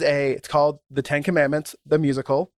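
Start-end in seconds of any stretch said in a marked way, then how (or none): none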